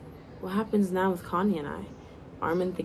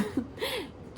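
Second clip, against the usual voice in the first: short laugh; 8 dB quieter than usual. Real, not acted. Reader laughs at own misreading.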